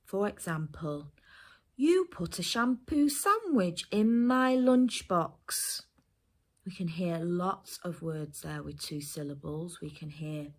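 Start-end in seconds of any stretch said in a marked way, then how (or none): 1.7-5.8 slow, higher, louder dictation voice. Shown as a demonstration. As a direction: dictate it slowly and clearly to a child. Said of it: "grown-up giving you a sentence".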